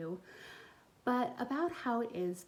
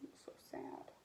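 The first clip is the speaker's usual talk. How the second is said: soft unvoiced sad aside; whispered aside; 15 dB quieter than usual. Real, not acted.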